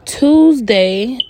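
as if just realizing the day; surprised. Real, not acted. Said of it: "Oh my God".

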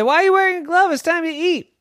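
high, loud mom voice; mother asking her boy, puzzled. Acted. Mother calling to dinner.

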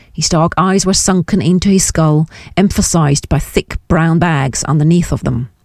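plain reading voice

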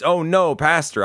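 high, flustered mock alarm; nervous. Caught woman startled by her pastor, high flustered cry. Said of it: "she gets nervous".

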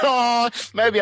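high, loud laughing "oh". Real, not acted. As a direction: burst out laughing on it. Laughs at host's rock star remark.

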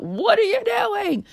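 high, loud, slow shriek; screaming at the drunk guy on the train, a shocked shriek; retold scream. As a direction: scream it out in alarm. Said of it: "and I just scream out".

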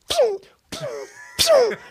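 high "pew" sound effects; sharp little mouth-made zaps; imitated police arrival. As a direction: punch out high-pitched siren chirps.

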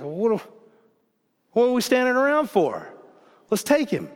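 put-on high, loud, fired-up teen; rally them: what are we waiting for; impatient boy spoiling for a fight; eager call to battle. Young David eager for battle.

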